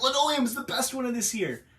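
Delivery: high-pitched mock fan of the film; imagined fan. Reviewer mimicking a viewer who might like it, high mock enthusiasm. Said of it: "Maybe you're like".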